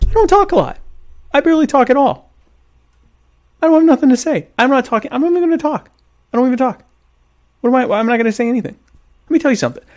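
high-pitched, comically defensive protest; mock indignation. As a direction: protest your innocence in a squeaky-high, put-upon voice. Mock indignant denial of talking.

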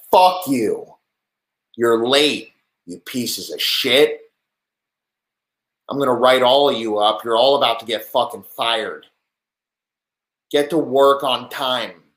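hammed-up boss yelling at late workers; angry boss. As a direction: bark at them like a boss whose staff are late. Plays a boss scolding late workers.

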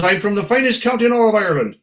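proud Irish lady. Acted. Little lady bragging about her county, proud Irish brogue. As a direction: boast fast in an Irish lilt. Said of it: "She goes".

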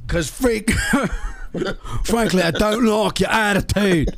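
high, laughing cartoon Cockney; mock Cockney. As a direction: ham up a Cockney geezer.

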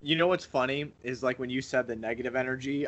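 ordinary talk, nothing marked

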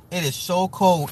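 normal talking voice

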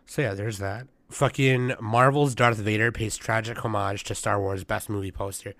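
ordinary talk

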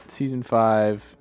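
usual speaking voice

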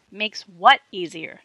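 slow, loud insistent question; demanding. A narrator's reading. Jerry demands an answer from the girls, slow loud question. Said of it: "demanded Jerry".

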